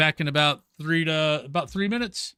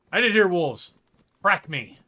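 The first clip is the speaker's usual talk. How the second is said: ordinary talk, nothing marked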